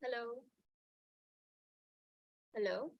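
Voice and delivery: very faint, far from the mic; barely audible. Real, not acted. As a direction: voice too low to hear clearly. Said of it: "your voice is very low".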